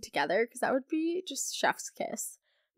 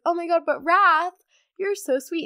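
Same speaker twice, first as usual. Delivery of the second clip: much higher, louder lovestruck gush; swooning gush; 7 semitones higher, 9 dB louder than usual. Retold or imitated. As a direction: gush over him like a smitten reader. Reader swooning over Wrath.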